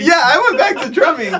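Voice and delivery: loud, high, through real laughter; delighted laughing confirmation; laughing while talking. Real, not acted. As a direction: say it cracking up. Laughing along with the listener.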